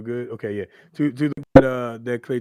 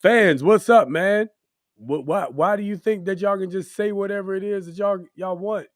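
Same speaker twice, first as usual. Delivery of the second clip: high, loud challenge to the crowd; calling out fans; 8 semitones higher, 7 dB louder than usual. Pretend. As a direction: call the fans out, indignant.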